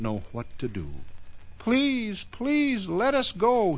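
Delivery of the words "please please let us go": very high, small pleading voice; terrified little boy's plea; frightened, shivering boy. Acted. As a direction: beg in a high, frightened child's voice. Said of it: "shivered Little Thunder".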